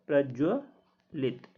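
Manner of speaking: प्रज्वलित drawn out very slowly, syllable by syllable; slow model pronunciation. Shown as a demonstration. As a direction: spell out प्रज्वलित slowly for students to copy. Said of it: "single आता है".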